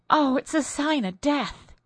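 high, hushed horror; dread. Acted. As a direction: say it like a curse. Wife sees omen of death.

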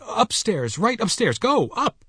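very high, panicky urging; hysterical. A narrator's reading. Terrified nurse sends Mister Button upstairs, hysterically. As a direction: shoo him away in a high-pitched panic. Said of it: "she cried hysterically".

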